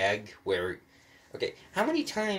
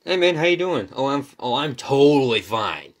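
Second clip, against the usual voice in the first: loud, high, hammed-up small talk; exaggerated mock-casual exchange; acted alternative dialogue; 5 semitones higher, 11 dB louder than usual. Shown as a demonstration. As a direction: play both sides loudly, stretching the last reply. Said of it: "here's an alternative".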